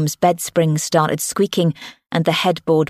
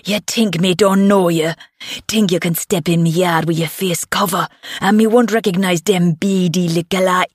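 put-on angry, challenging Jamaican accent; Jamaican-accented stepdad, riled up; thickening Jamaican accent. A narrator's reading. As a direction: taunt the intruders in thick Jamaican patois. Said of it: "his Jamaican accent thickening with anger".

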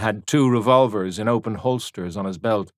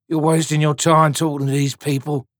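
louder, contemptuous growl; sneering tan; 5 semitones higher, 5 dB louder than usual. A narrator's reading. Tan to soldier, scornful and louder. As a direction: dismiss them with scorn.

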